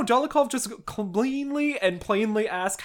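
usual speaking voice

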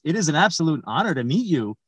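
normal talking voice

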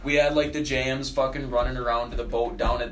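ordinary talk

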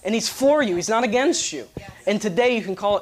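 a hint of street preacher urgency; preaching the gospel. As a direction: preach the gospel to a group on the lawn. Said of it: "I preached the gospel to him".

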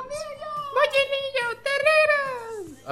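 chillido agudísimo de niñita por bocina; niñita pregonera. Retold or imitated. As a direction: imita a una niñita gritando su pregón, muy agudo y alargado. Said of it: "gritaba una niñita en, en la bocina". (grito de niña chiquita en bocina)

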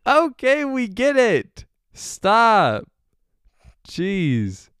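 loud, high, laughing exasperation; shouting at the noisy GoPro to stop; exasperated shout. Real, not acted. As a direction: yell at the beeping camera to stop.

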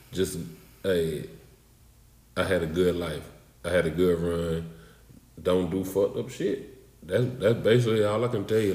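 low, soft and earnest; sincere advice. Real, not acted. Guest giving his final wisdom to listeners, sincerely. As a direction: speak it gently from the heart.

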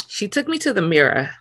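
ordinary delivery

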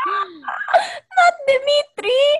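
high gasp then not Dimitri; mock horror. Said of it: "I was like".

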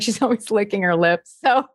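usual speaking voice

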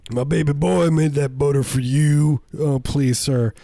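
proud vendor voice. Acted. Acted vendor pitch, laughing.

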